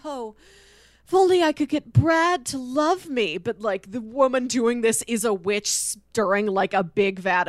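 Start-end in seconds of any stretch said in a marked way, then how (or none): 0.0-3.4 lightly swooning pining voice, a touch higher; hint of a lovesick comic heroine; mock romance comic heroine. Friend mimicking a pulpy romance comic heroine, lightly swooning. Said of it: "pulpy romance comics where it's like".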